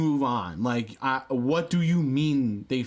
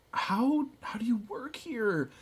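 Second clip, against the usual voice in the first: incredulous; 10 semitones higher, 3 dB quieter than usual. Retold or imitated. Incredulous question to coworker.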